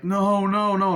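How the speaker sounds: high, loud repeated wails; acted despairing yell; anguished cries. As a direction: wail as it blows away. Acting out money blowing away.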